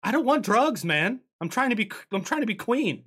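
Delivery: high whiny villain voice; Ursa annoyed. Acted. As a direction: whine it up high, offended. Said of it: "and she's like".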